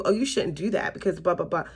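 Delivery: ordinary delivery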